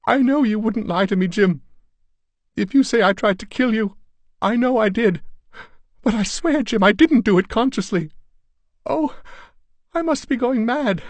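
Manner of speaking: high, loud, near-hysterical pleading; frightened wife at breaking point; distraught. Acted. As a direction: beg him to believe her, terrified of herself. Distraught wife fears she's mad.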